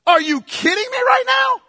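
high-pitched, incredulous outburst; Gabriel in disbelief. Acted. Angel can't believe Zechariah's unbelief, incredulous. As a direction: exclaim it in mock outrage, pitch soaring. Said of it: "it's like Gabriel can't believe it".

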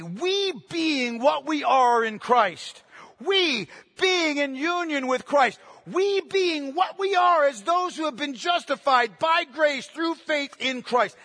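emphatic preaching that makes "we" the focus; stress on "we". Shown as a demonstration. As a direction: lean hard on every opening "we". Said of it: "the focus is on that word".

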